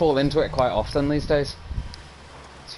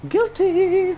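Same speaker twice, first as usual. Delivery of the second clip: mock guilty; 4 dB louder than usual. Echoing friend's word mockingly.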